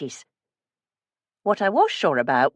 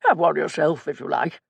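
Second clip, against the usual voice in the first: posh actor voice. A narrator's reading. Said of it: "in his best posh actor voice".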